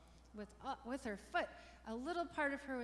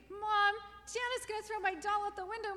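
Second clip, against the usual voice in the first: very high, loud panicked call to Mom; frantic plea; 10 semitones higher, 9 dB louder than usual. A narrator's reading. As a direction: cry out for help. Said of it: "Julie went crazy".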